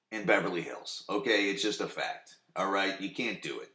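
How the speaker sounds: ordinary delivery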